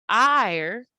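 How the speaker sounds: "ire" drawn out with weight; stretched out. Shown as a demonstration. Teacher showing a stressed syllable in fire, stretched out. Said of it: "stretches out".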